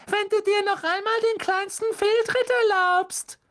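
extremely high, screeching mock mother; shrill mocking scolding; piercing shrill imitation. A narrator's reading. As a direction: shriek it mockingly at the top of your range. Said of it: "Mit durchdringend schriller Stimme".

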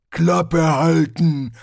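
loud, slow barbarian bark; a brute's slow, loud order; Hrun barking. Acted. Barbarian snaps at the sword.

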